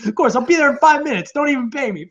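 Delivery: high, gushing mock eagerness; mock eager acceptance. Alfred Molina begging to take the part, hammed-up eager. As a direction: gush like a starstruck actor. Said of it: "imagined eager actor accepting".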